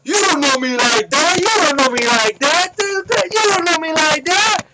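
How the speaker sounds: hammed-up outraged shouting, stretched at the end; imitated angry yelling. Young guy yelling after being pushed, mock furious.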